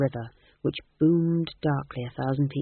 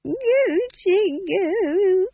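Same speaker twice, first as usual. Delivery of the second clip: very high, very slow cooing; baby talk; 6 dB louder, much slower than usual. A narrator's reading. Nanny Og cooing at the baby, high slow baby talk. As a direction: coo at the baby.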